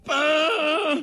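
high, drawn-out boom; echoed explosion. Acted. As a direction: echo the blast with a high, long cry.

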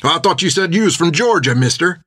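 a hint of a rough drunk patron; lightly voiced tipsy tavern man; gruff drunk man. A narrator's reading.

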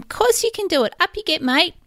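put-on high, bright coaxing to a small child; encouraging mum. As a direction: cheer the little boy on. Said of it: "I'm saying to him".

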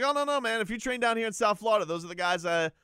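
lightly hammed-up fake sincerity; insincere reassurance. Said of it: "such an insincere thing to say".

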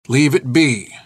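firm, understated command; stern order. Acted. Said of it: "It was an order".

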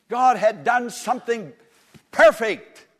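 high, loud cry on perfect; preaching climax. Real, not acted. Preacher to congregation, crying out perfect. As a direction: hit perfect at full voice.

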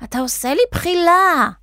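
high-pitched indignant character voice; acted outraged squeal; angry cry. A narrator's reading. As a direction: complain angrily, pitch climbing high. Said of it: "קראה בכעס".

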